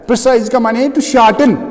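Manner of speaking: ordinary talk, nothing marked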